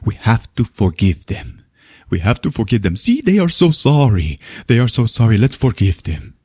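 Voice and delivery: put-on Spanish-accented soothing voice; Javier. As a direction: plead gently in an imitated Spanish accent. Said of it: "And Javier's like".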